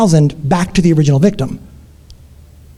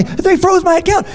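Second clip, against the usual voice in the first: panicked; 10 semitones higher, slower than usual. Retold or imitated. Victim answering angry boss.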